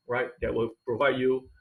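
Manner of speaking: ordinary talk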